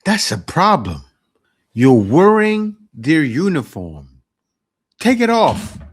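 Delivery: loud, emphatic scolding; loud outburst. Real, not acted. Cohost loudly tells the fired guard off. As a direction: raise the voice to lay down the law. Reacting to the firing story.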